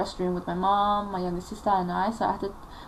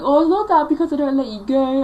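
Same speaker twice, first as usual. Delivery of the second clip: higher, louder imitation of a mother; mock arguing mother; 6 semitones higher, 8 dB louder than usual. Mother defends not letting daughter go, mock arguing. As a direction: throw back a mother's excuse, pitched up.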